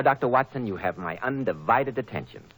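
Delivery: usual speaking voice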